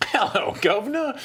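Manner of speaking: Cockney hello; mock Cockney. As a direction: tip the cap like a London street kid. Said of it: "do impressions".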